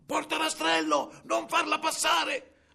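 high, slow, drawn-out holler; shouting from afar. A narrator's reading. Ogress ordering the gate from afar, long high shout. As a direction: holler it across a great distance. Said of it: "l'orca gridò da lontano".

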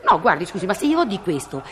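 normal talking voice

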